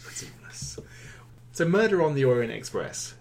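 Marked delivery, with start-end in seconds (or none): 0.1-0.8 hushed, whispered aside; whispered echo. Real, not acted. Host echoing the co-host's aside, whispered. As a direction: whisper it back to your partner.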